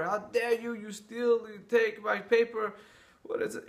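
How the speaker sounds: hammed-up outrage at a paper thief; furious outburst. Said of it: "He flipped out on the neighbor".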